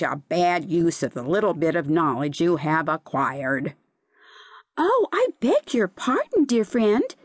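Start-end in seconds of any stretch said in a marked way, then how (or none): 4.8-7.1 high, eager apology; high-pitched cry of apology to a friend; cried out. A narrator's reading. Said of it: "cried Seaweed".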